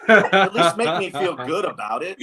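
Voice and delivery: high, loud comic complaint; mock indignation. Pitcher complaining to the hitter who just guessed, mock indignant. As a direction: protest in exaggerated exasperation, playing for laughs.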